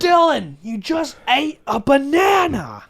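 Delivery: high, loud, slow mock yell; mock shout. Friend playfully yelling at his podcast partner, loud. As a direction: yell it like an outraged parent.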